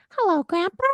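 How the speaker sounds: hello grandpa squeaked very high; be silly and squeak it up high; playful squeaky greeting. Shown as a demonstration. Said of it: "get playful".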